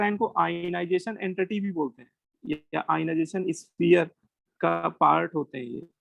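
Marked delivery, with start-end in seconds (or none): none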